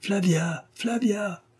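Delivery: high, excited calling out of a name; joyful cry. Acted. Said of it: "s'écriaient les voyageuses". (appel joyeux, aigu et exalté)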